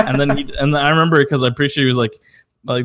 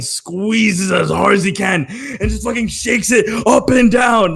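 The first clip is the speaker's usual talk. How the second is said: excited; 7 semitones higher, slower than usual. Real, not acted. Describing violent handshake.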